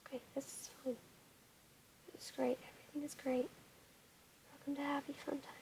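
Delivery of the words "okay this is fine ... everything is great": hushed, breathy whisper. Real, not acted. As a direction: whisper so nobody wakes. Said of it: "Did I get quieter as the video progressed".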